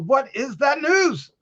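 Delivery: ordinary talk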